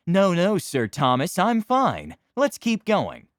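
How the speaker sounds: high, loud, haughty nobleman; pompous high-born knight; arrogant noble voice. Acted. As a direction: look down your nose at him, loudly. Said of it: "In my best high-pitched, arrogant noble voice".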